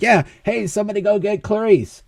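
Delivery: high-pitched call to coworkers; mock holler without much volume; acted calling out. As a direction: pitch it up as if calling across a room.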